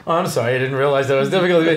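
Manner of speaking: a fast, mock-polite American accent; imitating an American accent. Friend on a panel switches into an American accent, fast. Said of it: "He switches into perfect American English".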